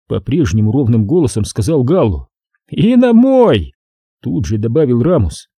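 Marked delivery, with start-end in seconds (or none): 2.7-3.7 mocking. Ramos chiming in.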